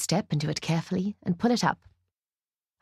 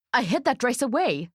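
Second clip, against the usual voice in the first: higher, tense angry narration; fuming; 5 semitones higher than usual. A narrator's reading. Ruth confronts the bride over the dress, fuming. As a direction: fume through each word. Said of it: "she fumes".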